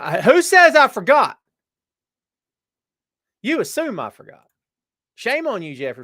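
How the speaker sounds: mock indignant. Mock offended at chat.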